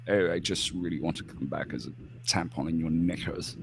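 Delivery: a hint of a posh English royal; poncy English accent. Mocking King Charles with a soft, poncy English accent. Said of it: "in his poncy little accent".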